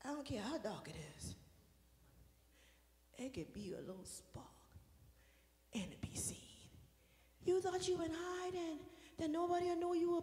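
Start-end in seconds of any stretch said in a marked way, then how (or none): 0.0-6.4 sudden soft whisper. Acted. Pastor hushing the church about a spark, whisper. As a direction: drop to a hush for effect.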